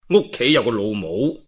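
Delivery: ordinary delivery, nothing marked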